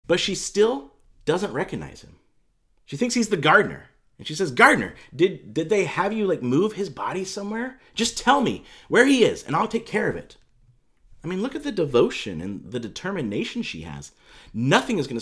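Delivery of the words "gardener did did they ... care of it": raised, insistent appeal; acted desperate request; urgent pleading. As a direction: beg for an answer, pushing the key words. Acting Mary pleading with the gardener.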